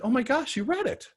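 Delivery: imitating a stunned agent who can't believe it; shocked delight. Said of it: "first she was shocked".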